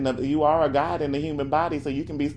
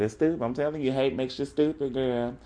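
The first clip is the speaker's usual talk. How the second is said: usual speaking voice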